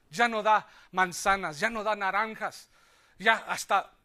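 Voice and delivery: loud, driving sermon cadence; forceful preaching. Acted. Preacher to the congregation, loud and driving. Preaching about the dried tree.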